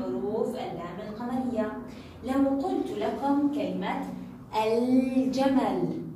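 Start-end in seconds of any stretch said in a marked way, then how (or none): 4.7-6.0 "الجمل" very slow, with a long ل; pronounced lam. Shown as a demonstration. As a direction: hold the L of الجمل, then sound the ج. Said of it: "إنه حرف الجيم من حروف اللام القمرية".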